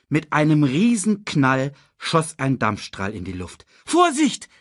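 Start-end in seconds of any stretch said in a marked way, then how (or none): 3.8-4.5 very high, loud alarm; shouted warning. A narrator's reading. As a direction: cry out to warn a friend. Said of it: "rief Simba".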